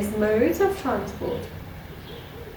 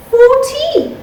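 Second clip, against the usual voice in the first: excited; 14 semitones higher, 8 dB louder than usual. Acted. Teacher counting up to the end.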